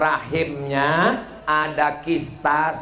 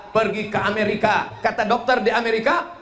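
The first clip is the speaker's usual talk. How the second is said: normal talking voice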